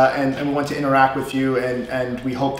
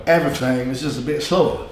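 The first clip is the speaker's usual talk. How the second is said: Southern accent; 2 semitones lower than usual. Shown as a demonstration. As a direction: stretch the vowels like a Southerner. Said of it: "when you talk Southern".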